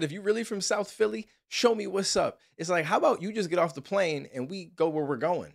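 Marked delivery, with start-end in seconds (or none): 0.0-2.4 high, mock tough-talk quote; mocking imitation. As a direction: ham up her challenge. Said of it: "She said".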